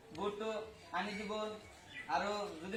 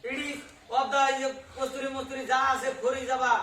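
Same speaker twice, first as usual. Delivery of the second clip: loud, raised-pitch oration to a crowd; hammed-up speech in broken English; mock public speech; 3 semitones higher, 14 dB louder than usual. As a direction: declaim it loudly to the audience.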